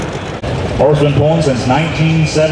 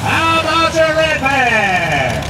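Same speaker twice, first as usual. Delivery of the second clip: drawn-out crowd call; 11 semitones higher, much slower than usual. Acted.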